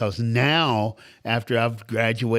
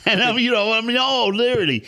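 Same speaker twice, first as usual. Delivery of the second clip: high, laughing words; 12 semitones higher, 5 dB louder than usual. Real, not acted.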